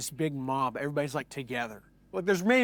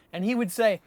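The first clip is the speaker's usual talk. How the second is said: plain reading voice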